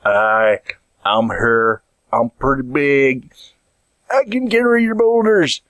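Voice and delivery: slow, simple big-guy voice; big medieval strongman. Acted. Big man offers builders his muscle, slow put-on oafish voice.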